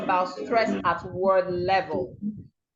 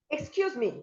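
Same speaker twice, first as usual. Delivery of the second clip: "Excuse me" pitched higher and softer; higher, soft exclamation on "Excuse me"; expressive intonation; 4 semitones higher, 6 dB quieter than usual. Shown as a demonstration. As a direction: express yourself through the tune of "Excuse me". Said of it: "when you are expressing yourself".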